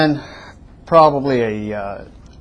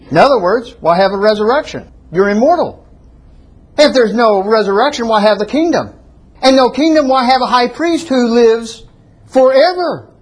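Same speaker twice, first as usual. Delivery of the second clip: high, raised voice, building to "forever"; emphatic rhetorical challenge; 8 semitones higher, 3 dB louder than usual. Real, not acted. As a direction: press each question harder and higher. Preacher argues rhetorically against the philosophy.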